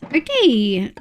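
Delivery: ordinary talk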